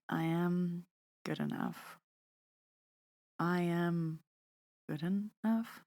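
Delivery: I am good enough with doubtful questioning rises, soft and unsure. Shown as a demonstration. Podcast host showing listeners the wrong questioning way. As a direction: ask it as if unsure it's true. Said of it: "Like a question".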